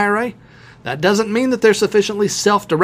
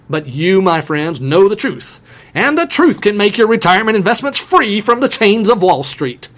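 mock revival preacher, rising higher and louder; evangelist voice; 4 semitones higher, 3 dB louder than usual. Host tells listeners they know the truth, mock evangelist proclamation. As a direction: proclaim it like an evangelist working up the congregation. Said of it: "in his best evangelist voice".

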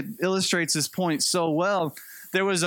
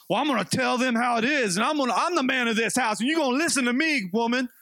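higher, faster, puffed-up bossy rant; blustering man of the house; 6 semitones higher, faster than usual. Retold or imitated. Husband to his wife, asserting he's the man of the house, mock angry. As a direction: lay down the law, hammed-up.

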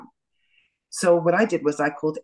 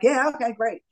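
unworried reply; 8 semitones higher than usual. Retold or imitated. Unworried reply to results call.

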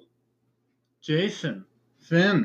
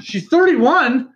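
high, slow shocked question; stunned disbelief; surprised; 9 semitones higher, 3 dB louder, slower than usual. Real, not acted. Shocked at her age.

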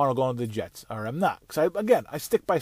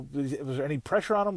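ordinary delivery

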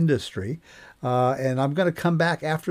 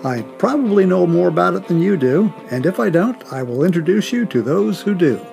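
louder, punched-up announcer read; podcast intro read; 6 dB louder than usual. Acted. Host introducing himself to new listeners, podcast intro read. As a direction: introduce the show with projected authority. Scripted show intro over music.

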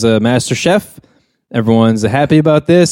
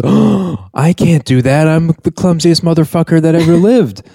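higher-pitched self-doubting protest; hammed-up refusal, pitched up; acted protest; 6 semitones higher than usual. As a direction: protest in a raised, mock-dismayed voice. Acted panicked refusal of promotion.

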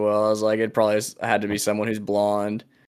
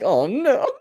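laughing mock dismay; 9 semitones higher than usual.

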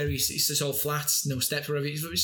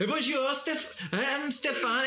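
high, slow put-on character voice; Stefan voice; 10 semitones higher, much slower than usual. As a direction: play the imagined friend Stefan, pitched up. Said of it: "not only did he do the voices".

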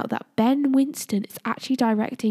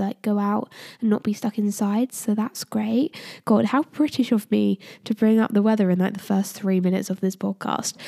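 usual speaking voice

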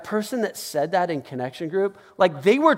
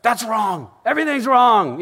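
raised, indignant mock outburst; hammed-up loud condemnation; mock discernment blogger; 4 semitones higher, 4 dB louder than usual. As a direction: denounce it like a fault-finding blogger. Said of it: "I become like a discernment blogger".

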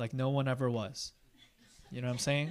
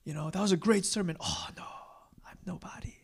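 soft mock humble, ending in a whisper; false modesty; 4 semitones higher, 5 dB quieter than usual. Pastor brushing off sermon praise, mock humble whisper.

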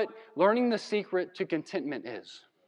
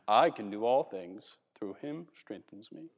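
lower, softer smug self-praise; mock narcissistic boast; 7 semitones lower, 4 dB quieter than usual. Pastor mocking the misreading of the verse, smug boast. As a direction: read it like a boast about yourself. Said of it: "having this narcissistic cry".

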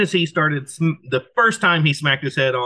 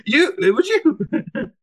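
much higher, slow mock accusation; high mock accusing; 14 semitones higher, slower than usual. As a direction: stretch it out high, teasing. Repeating the accusing quote, hammed.

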